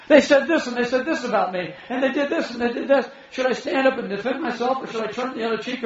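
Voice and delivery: high, agitated mock complaint; imitating someone worked up over slander; acted grievance. Acts out an aggrieved complainer.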